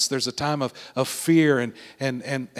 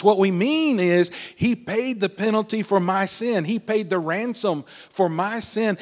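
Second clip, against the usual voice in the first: fervent preaching; 5 semitones higher than usual. Real, not acted. Pastor to congregation, fervent climactic preaching. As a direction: preach it with rising fervor.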